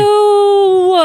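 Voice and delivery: high, loud disgusted ew. Real, not acted. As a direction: recoil in real disgust. Disgust at clowns.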